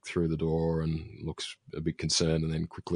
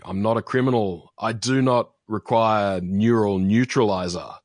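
indignant imitated rant, raised and louder; mock rant; 4 semitones higher, 6 dB louder than usual. Recapping a sickbay patient protesting his treatment, mock ranting. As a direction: retell it as an emphatic, protesting rant. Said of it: "Van Gelder's ranting".

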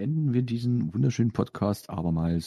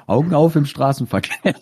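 laughing; 7 semitones higher, 7 dB louder than usual. Acted. Laughing slogan quote.

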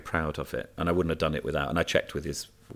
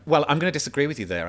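higher, brisk politician rebuttal; imitating Nadine; 8 semitones higher, 4 dB louder, faster than usual. Minister rebutting him, brisk mock politician tone. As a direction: snap into defensive politician mode, quickly. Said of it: "then Nadine went".

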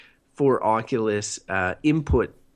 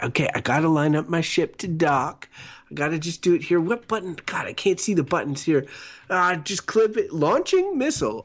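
rising into high-pitched mock panic; a pilot fumbling, voice climbing to a squeal; mock docking panic; 4 semitones higher than usual. Friend imitates a VR pilot panicking while docking. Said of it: "You're like".

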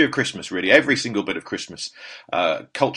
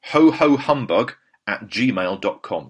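slow dictation; 5 dB louder, slower than usual. Shown as a demonstration. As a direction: spell it out slowly. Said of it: "spelling out email".

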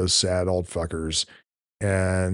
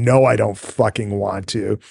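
usual speaking voice

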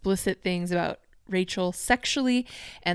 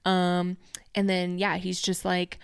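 normal talking voice

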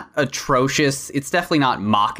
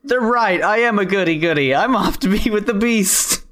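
martyred Beauty; 7 semitones higher than usual. Retold or imitated. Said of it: "Jem as Beauty just climbs up on that cross".

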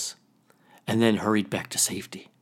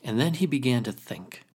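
ordinary delivery

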